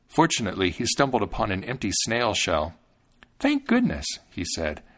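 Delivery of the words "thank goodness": put-on high, small voice of a thumb-sized boy; little Thumbling, fretful; Thumbling's tiny voice. A narrator's reading. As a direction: pitch it up like a tiny hero.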